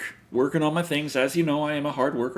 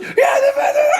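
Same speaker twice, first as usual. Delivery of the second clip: much higher, loud laughing panic; imitated panic; 8 dB louder, slower than usual. Imitating his partner panicking over a bug, high laughing squeak. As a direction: squeak it out, too panicked to finish. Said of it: "And she's like".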